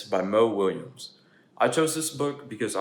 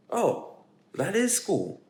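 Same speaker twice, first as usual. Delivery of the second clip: higher, a mild surprised realization; realizing pigeon; 8 semitones higher, 4 dB louder, much slower than usual. Acted. Pigeon realizes what school is, higher. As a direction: discover it's school.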